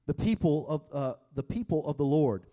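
loud, high preaching voice; preaching at full force. Acted. Pastor reading David's reply to the congregation, fervent preaching. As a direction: proclaim it to the whole room.

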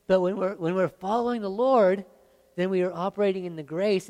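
loud, rising preacher's call; preaching at full force. Acted. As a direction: preach it out to the whole room. Preaching climax on following the Lord.

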